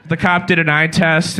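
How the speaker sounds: normal talking voice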